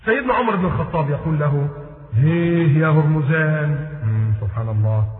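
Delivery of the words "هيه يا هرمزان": taunting drawl. Retold or imitated. Omar taunts the captive Hormuzan.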